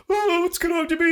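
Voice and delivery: high, fast, loud mock fright; mock panic. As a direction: whine it in hammed-up panic. Mimicking a panicked person in bed.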